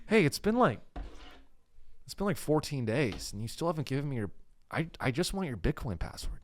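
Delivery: softly voiced kidnapper with a light Midwest accent; mild Midwest niceness, quietly pleading; Todd. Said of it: "He's, like, kinda Midwest".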